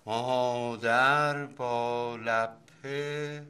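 very slow, stretched words, pitch climbing; slow dictation. Acted. Father dictates spelling homework to his child, slow dictation. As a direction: dictate it word by word, drawn out.

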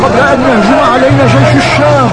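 high, loud public appeal; calling out to crowd. Acted. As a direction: rouse the crowd to flee. Agitator calls out to crowd.

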